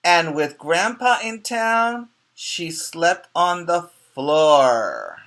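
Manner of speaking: slow, lilting storybook read; reading to children. Acted. Teacher reading the story to young pupils, storybook voice. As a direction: read it like a picture book to kids.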